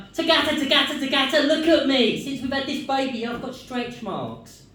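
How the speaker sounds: high, loud mock wife calling him; shrill-free high whiny wife impression; nagging wife. Wife calling him from the mirror, high and loud. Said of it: "and she's like".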